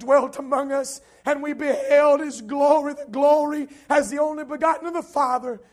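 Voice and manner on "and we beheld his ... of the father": loud, raised preacher's voice; full-force preaching. Real, not acted. Preacher declaring Christ's glory to the congregation, full-force preaching. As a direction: proclaim it loudly to the congregation.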